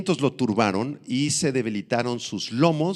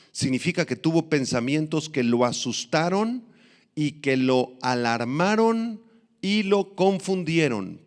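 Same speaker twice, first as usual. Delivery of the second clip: usual speaking voice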